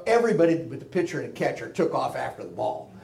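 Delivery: usual speaking voice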